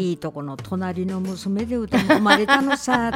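Tsugaru dialect intro. Shown as a demonstration. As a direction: drawl out the joke like on stage in Tsugaru dialect. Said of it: "っていう話から入るんですよ".

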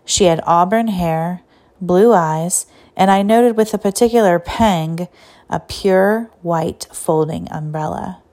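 usual speaking voice

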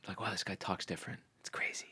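hushed, impressed whisper; mock whisper. Friend mimics women impressed by a foreign accent, whispering. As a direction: whisper it like a dazzled woman.

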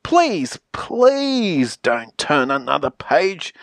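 drawn-out desperate plea; plead, stretching the second "please"; begging. Acted. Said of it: "I'm begging".

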